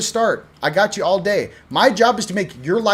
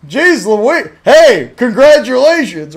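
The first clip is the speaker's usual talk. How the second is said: loud, slow, sarcastic cheer; mock congratulations; 5 semitones higher, 9 dB louder, slower than usual. Son teasing his dad about remembering dreams, loud mock cheer. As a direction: cheer him on with a big, slow, mocking hooray. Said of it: "And I'm sitting there going".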